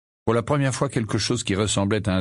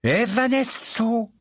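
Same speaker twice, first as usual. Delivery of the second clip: higher, slow drawn-out spell; spell incantation; 14 semitones higher, much slower than usual. Acted. Bill casting the vanishing spell, drawn out. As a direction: intone the spell slowly. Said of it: "Bill marmonna".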